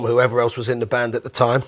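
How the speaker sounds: normal talking voice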